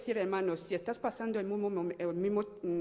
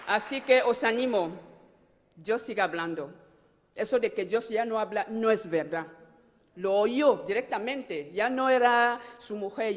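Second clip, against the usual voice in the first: fervent preaching; 3 semitones higher, 7 dB louder than usual. Real, not acted. Woman exhorting the congregation that God still speaks, loud preaching. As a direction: preach it to the whole church.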